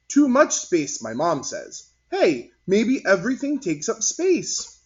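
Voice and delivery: put-on high, loud kid talking with glee; child voice. Martin chatting to classmates, high and lively. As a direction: bounce it up like an excited boy.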